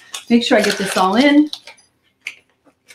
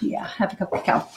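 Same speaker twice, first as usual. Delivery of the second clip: half a cup of cacao murmured softly, breathy and voiceless; quiet confirmation; 7 dB quieter than usual. Real, not acted. Cook confirming the amount to herself, hushed. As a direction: settle it to yourself under your breath.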